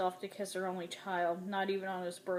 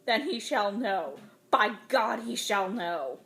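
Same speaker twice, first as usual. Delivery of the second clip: furious vow; 6 dB louder, slower than usual. A narrator's reading. Mother in rage.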